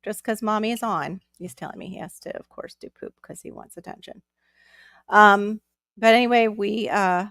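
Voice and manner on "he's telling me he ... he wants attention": whispered, much softer, confiding to viewers; whispered aside. Real, not acted. Aside about toddler off-mic.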